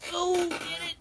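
high frightened pleading; panicked high voice. Real, not acted. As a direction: beg someone to kill the bug. Bug still in the room.

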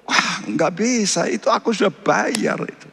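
high-pitched, mildly indignant protest. Acted. Acting out God refusing to let go. (protes dengan nada tinggi)

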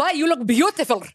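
badly faked German accent through the giggles. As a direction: play the German date, accent slightly off. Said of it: "I can do this accent".

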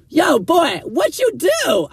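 high, loud shout across the street; passerby hollering at a handcuffed friend; shouted call from a car. Retold or imitated. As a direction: yell it from a passing car window.

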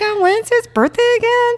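mock clueless friend. Retelling a friend who forgot her own boyfriend's birthday, in a high ditzy voice. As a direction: pitch it way up like a scatterbrained girl. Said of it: "She goes".

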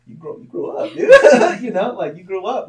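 loud, high, laughing praise; laughing amazement at your own growth; proud self-congratulation. Acted. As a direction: crack up while cheering yourself on. Proudly congratulates his past self.

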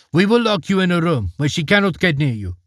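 put-on calm the frightened girl lightly; reassuring servants.

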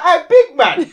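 loud, high mock callout with a laugh; yelling hey big man at the parked driver, laughing; retold shout. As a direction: barge in and call him out, grinning.

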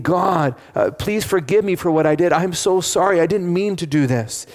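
high, loud, desperate begging; tearful pleading. Retold or imitated. The killer begs Adele through tears, loud and pleading. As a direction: cry out for forgiveness in tears. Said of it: "and tears and pleading".

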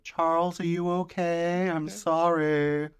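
high, very slow, drawn-out simpering; hammed-up worried woman; mock apologetic concern. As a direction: coo an apology, stretching every word. Acting woman apologising to her attacker.

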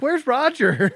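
put-on high, laughing little girl; high child voice. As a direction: squeal it like a toddler. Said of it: "She's like".

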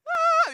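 very high, loud goofy "aah"; goofy yell. Retold or imitated. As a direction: imitate a silly, gleeful squeal. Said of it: "Emma sees me all goofy".

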